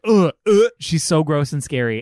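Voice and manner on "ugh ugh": high loud disgusted ugh; grossed-out groan. Retold or imitated. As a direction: recoil in disgust. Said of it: "I was just like".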